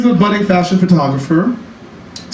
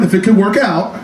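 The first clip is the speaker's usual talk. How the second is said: usual speaking voice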